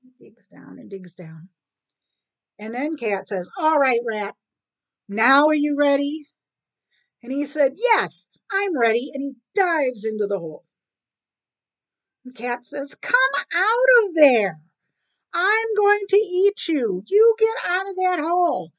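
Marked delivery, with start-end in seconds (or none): 3.6-6.3 loud, insistent demand; impatient cat. Acted. Cat asks waiting rat, impatient. 7.8-9.1 higher, louder rat reply; lightly voiced rat, answering up brightly; defiant rat. Rat answers cat before diving, emphatic. 12.9-18.7 furious cat. Acted. Cat yells at rat in hole, furious.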